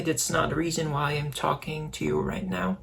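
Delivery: ordinary talk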